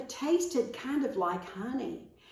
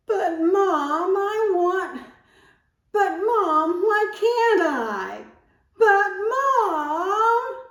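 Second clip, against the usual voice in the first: loud crying whine, much higher; higher, louder crybaby pleading; whiny arguing child; 11 semitones higher, 10 dB louder, slower than usual. Shown as a demonstration. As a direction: wail at Mom like a protesting kid. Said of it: "Disputing is arguing loudly".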